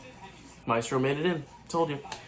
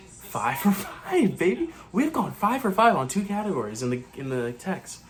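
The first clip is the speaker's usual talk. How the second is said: excited; 6 semitones higher than usual. Real, not acted. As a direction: savour the win. Celebrating correct predictions.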